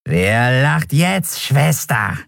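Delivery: high, very slow mocking creature voice; gloating skeleton. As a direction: savour each word of the gloat.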